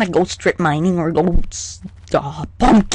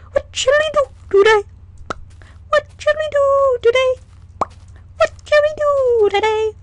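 much higher, squeaky singsong; shrill singsong. Pretend. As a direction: torment their ears, squeaky. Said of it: "I'll stop making your ears bleed".

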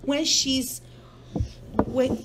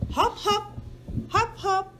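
high, light hopping beats; spring each hop up; bouncy high hops; 9 semitones higher than usual. Acted. Mother to child, grasshopper cheering Hippo, bouncy high hops.

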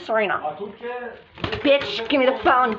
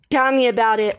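ordinary talk

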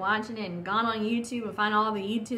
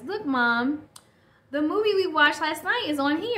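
put-on higher, louder little-boy voice; imitating excited son; 6 semitones higher, 5 dB louder than usual. Son tells mom he found last night's movie, imitated excited child. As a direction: lightly play an eager kid making a discovery. Retells son's discovery on his Kindle.

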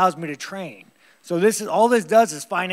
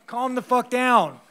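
mock shout; 6 semitones higher, 4 dB louder, slower than usual. Mock rant at Canada.

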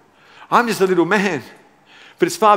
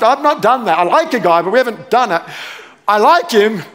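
high, stammering embarrassed protest; flustered Mary; 4 semitones higher than usual. Acted. Preacher playing Mary protesting her virginity, comically flustered. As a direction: stumble through an awkward denial, pitch jumping up. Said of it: "just spare a thought for Mary".

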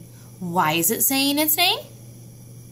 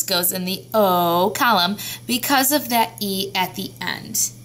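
normal talking voice

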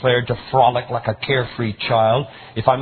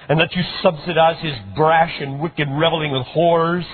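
bitter outburst; 6 semitones higher than usual. A narrator's reading. Older son's bitter outburst.

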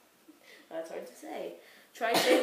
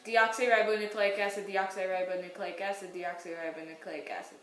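rapid-fire repeats, dropping lower and softer; said three times fast; 5 semitones lower, 7 dB quieter than usual. Shown as a demonstration. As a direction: rattle the long word off three times without a break. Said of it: "Say it three times fast".

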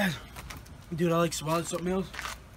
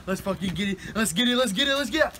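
psyching himself up. Real, not acted. Hyping himself before eating the hottest pepper, building excitement. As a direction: pump yourself up with each repeat.

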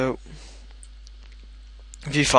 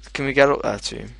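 ordinary talk

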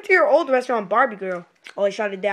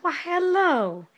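slow, drawn-out hello in an odd voice; old style greeting; 6 semitones higher, much slower than usual. Acted. Shopkeeper trying the old style greeting, slow. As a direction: stretch the greeting out slowly.

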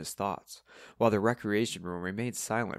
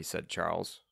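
usual speaking voice

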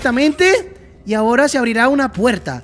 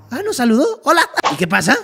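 normal talking voice

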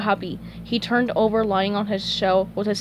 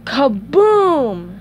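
loud, booming kaboom; explosion effect; 4 semitones higher, 6 dB louder than usual. A narrator's reading. Narrator ending the chapter on a blast, loud boom. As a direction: burst out on the last syllable.

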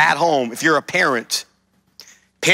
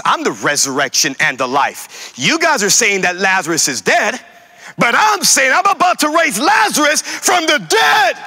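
building shout; 8 semitones higher than usual. Acted. Voicing Jesus, building up.

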